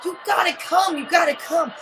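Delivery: loud, insistent calling; excited urging. Retold or imitated. Friends shouting at him to come, loud and pushy.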